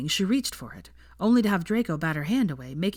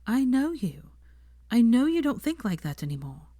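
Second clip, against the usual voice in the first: higher, heartfelt insistence; earnest reassurance; 7 semitones higher than usual. A narrator's reading. Hermione insists to Draco that he has changed, earnestly. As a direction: convince him he has changed.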